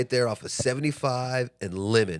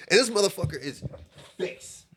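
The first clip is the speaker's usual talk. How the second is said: usual speaking voice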